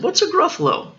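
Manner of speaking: lilting high-pitched question; high, wondering storybook voice; puzzled owl. Acted. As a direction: ask as a curious owl, pitched well up.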